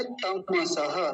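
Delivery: तमसह as ta-ma-sa-ha, every vowel sounded; full ma. Shown as a demonstration. Teacher modelling the correct word, syllable by syllable. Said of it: "तम ऐसा बोलेंगे".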